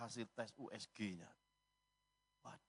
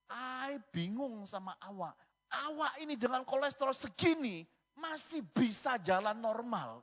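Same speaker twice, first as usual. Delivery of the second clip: high, exasperated mock scolding, rising louder; scolding doctor; 10 semitones higher, 7 dB louder than usual. Doctor scolding patient over his cholesterol, high and getting louder. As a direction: imitate a doctor baffled and cross, high-pitched. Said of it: "Dokternya marahin saya".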